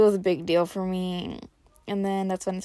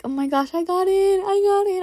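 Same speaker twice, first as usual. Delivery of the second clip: high squeal climbing and getting louder; re-enacted crying for joy; 12 semitones higher, 6 dB louder than usual. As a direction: build into an overjoyed, tearful cry. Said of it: "I started crying and I was just like".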